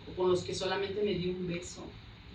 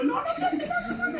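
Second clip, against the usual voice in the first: very high, loud mock protest; hammed-up reluctance, high-pitched; panicked refusal; 5 dB louder, slower than usual. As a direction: squeal out a string of refusals. Retells resisting being pulled to front.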